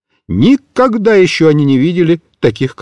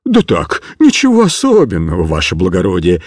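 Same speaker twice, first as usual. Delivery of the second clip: mild frightened babble; 10 semitones lower than usual. A narrator's reading. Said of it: "весь дрожа от страха лепетал цыган".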